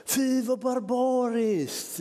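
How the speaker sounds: drawn-out high shocked exclamation; shocked old lady. Retold or imitated. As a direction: squeal it out like a scandalised old lady. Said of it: "Då ropar hon så här".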